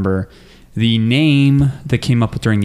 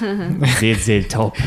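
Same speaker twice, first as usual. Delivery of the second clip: put-on New Zealand accent; much slower than usual. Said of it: "Is that your New Zealand accent?".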